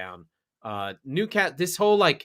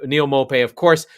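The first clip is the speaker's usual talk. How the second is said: normal talking voice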